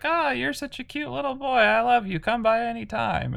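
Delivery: high-pitched mock gushing over a child; cooing witch. Retelling the witch fussing over the cute little boy, high cooing. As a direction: coo it way up high, imitating a doting woman.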